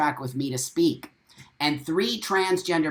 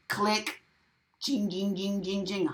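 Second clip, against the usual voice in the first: a soft, higher imitated click and phone ringing; mock phone click and ring; 4 semitones higher, 6 dB quieter than usual. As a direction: mimic the hang-up, then the phone dialing.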